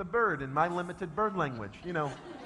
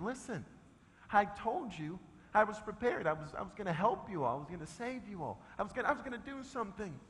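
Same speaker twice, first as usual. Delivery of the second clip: softer, gentle plea to the little bird; soft talk to a bird; 10 dB quieter than usual. Retold or imitated. Carrying the chick out, a soft coaxing murmur. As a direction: coax the chick quietly. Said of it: "talking to this bird".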